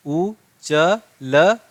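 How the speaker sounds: उ च ल, each syllable slow and separate. Shown as a demonstration. Teacher sounding out उ च ल again for students, slowly. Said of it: "कैसे होने वाला है प्रोनाउंसिएशन?".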